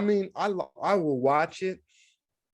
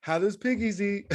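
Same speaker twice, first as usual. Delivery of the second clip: put-on little boy voice; 6 semitones higher than usual. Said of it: "the little boy's like".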